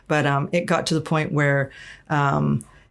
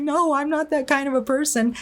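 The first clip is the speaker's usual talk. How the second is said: defensive protest; 10 semitones higher than usual. Retold or imitated. Retelling denying the bishop's claims, high indignant protest. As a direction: replay her disbelieving protest, pitched way up.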